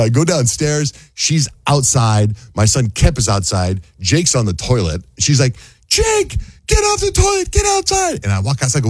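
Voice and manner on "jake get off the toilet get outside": mock yelling. Wife ordering son off the toilet, high mock yell. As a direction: mimic an urgent shriek way up high.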